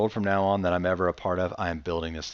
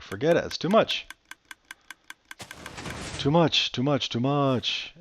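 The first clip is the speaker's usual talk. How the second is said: exasperated self quote; 5 semitones higher than usual. Retold or imitated. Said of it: "I'm like".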